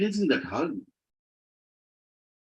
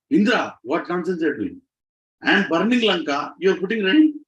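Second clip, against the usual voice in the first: rising, louder exasperated rebuke; indignant scolding; 6 semitones higher, 5 dB louder than usual. Retold or imitated. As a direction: scold him for ruining the fire. Hanuman scolds Indra over rain.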